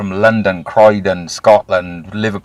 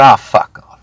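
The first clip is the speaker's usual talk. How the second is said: imitating fed-up hang-up; 11 dB louder than usual. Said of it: "You're gonna go".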